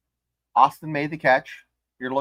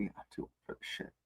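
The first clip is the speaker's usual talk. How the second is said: unvoiced whisper, fast; 20 dB quieter, faster than usual. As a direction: breathe it out under the breath. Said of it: "he's like".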